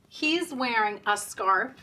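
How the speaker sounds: usual speaking voice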